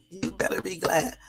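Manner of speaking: normal talking voice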